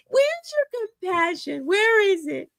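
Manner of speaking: mock-indignant high plea; mock outrage. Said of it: "they're like".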